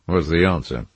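ordinary delivery

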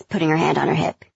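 ordinary delivery

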